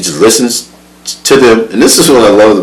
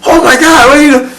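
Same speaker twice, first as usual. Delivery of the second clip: put-on shocked kids; 4 dB louder than usual. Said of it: "Kids everywhere".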